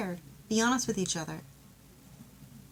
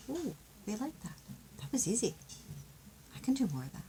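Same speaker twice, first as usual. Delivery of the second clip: pleased partner; 3 semitones higher, 7 dB quieter, faster than usual. Retold or imitated. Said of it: "the other person a chance to go".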